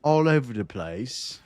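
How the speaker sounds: slow, drawn-out mock British accent. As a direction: imitate the slow British TTS voice. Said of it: "Well, he's British".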